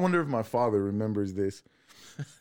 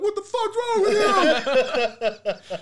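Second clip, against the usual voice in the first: high, loud mock yell out a car window; yelling driver; 6 dB louder than usual. Angry driver yells at the kids flipping off cars. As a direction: holler at two kids by the road.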